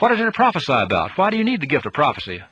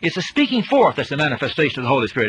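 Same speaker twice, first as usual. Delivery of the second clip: usual speaking voice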